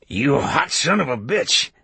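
indignant oath with a touch of strain; mild angry cursing. A narrator's reading. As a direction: curse through the door, voice climbing. Man cursing at burning door.

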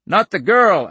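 high, loud urgent shout. A narrator's reading. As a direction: shout it out in alarm. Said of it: "Al shouted".

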